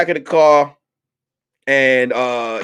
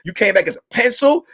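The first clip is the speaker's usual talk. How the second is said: normal talking voice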